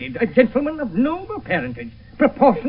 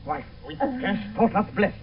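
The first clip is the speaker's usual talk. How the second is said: ordinary talk, nothing marked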